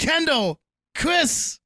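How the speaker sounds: names called high, loud; hammed-up high-pitched calling; mock roll call. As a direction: proclaim each name like damning proof. Shouting out the list of names.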